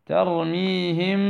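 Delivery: ترميهم slow, meem hidden with nasal ghunna before بحجارة; ikhfaa demo. Shown as a demonstration. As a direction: drag ترميهم with two-count ghunna. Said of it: "الغنة موجودة". (ترميهم بغنة حركتين)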